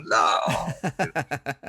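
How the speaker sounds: high, wavering comic wail; mock dismayed no. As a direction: wail it as if wounded, for laughs. Mock cry at being aged.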